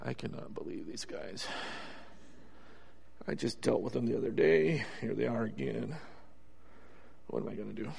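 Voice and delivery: soft unvoiced grumble under the breath; mumbling to himself. Jesus exasperated at the Pharisees, mumbling privately, hushed. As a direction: mutter it to yourself, barely audible. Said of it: "he was mumbling to himself".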